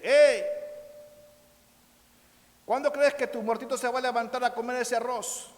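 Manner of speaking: loud, high taunting hey; missionary jeering at the offering; mocking call-out. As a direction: shout across the cemetery to mock. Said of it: "el misionero americano le dice".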